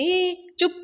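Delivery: high, exasperated hushing cry; yelling. Acted. Flustered woman silencing everyone's suggestions at once, yelling. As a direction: cut them off with a high-pitched yell. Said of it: "Avni ओरडते आणि म्हणते".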